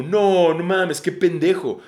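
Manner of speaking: scornful, drawn-up "no way" reaction; mocking disbelief. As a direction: imitate people scoffing at someone's gullibility. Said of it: "mucha gente dice como".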